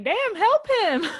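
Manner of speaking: high, loud plea breaking into laughter; laughing exclamation. Acted. Scolding Kim for not helping him, laughing outburst.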